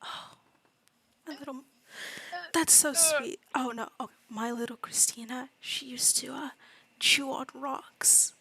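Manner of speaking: whispered gossip. Acted. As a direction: whisper a fond story. Townswoman confiding softly.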